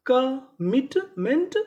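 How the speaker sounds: Commitment stretched slowly, rising at the end; very slow syllable by syllable. Shown as a demonstration. Teacher to students, dictating the word very slowly. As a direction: pull commitment apart slowly. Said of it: "तो पूरी meaning".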